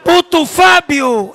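slow loud call across the room; loud drawn-out hail. Real, not acted. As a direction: shout his name to get his attention. Calling out to Fábio.

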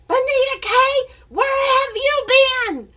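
high tearful mock shout; crying angry mom. Crying mom finding her late daughter, tearful shout. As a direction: cry and shout the daughter's name. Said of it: "she started crying and said".

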